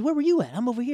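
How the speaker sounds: high, very loud calling; imitated frantic shouting. Friends finding each other after he ran, shouting. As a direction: call out across a playground.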